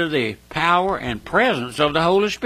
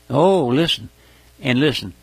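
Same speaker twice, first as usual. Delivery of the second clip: ordinary delivery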